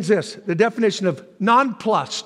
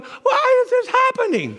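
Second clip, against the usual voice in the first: mock wailing; 4 dB louder than usual. Believers complaining amid difficulty, hammed-up wail. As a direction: wail it in exaggerated helplessness.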